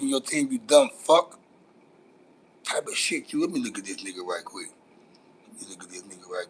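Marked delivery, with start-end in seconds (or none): 5.5-6.5 whisper. Real, not acted. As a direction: whisper it to yourself, leaning in. Checking a viewer's photo.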